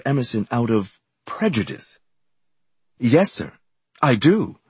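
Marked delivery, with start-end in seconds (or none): 3.0-4.6 angry retort, pitched high and louder; indignant comeback. A narrator's reading. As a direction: answer back hotly, voice raised. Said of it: "I said angrily".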